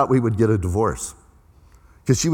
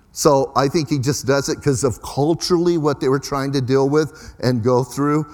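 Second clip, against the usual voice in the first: ordinary talk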